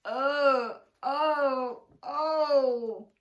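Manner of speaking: loud, high wailing groans of pain; crocodile groaning. Acted. Crocodile in terrible pain wails out loud. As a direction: cry out as if your jaw is on fire. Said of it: "a loud groaning sound".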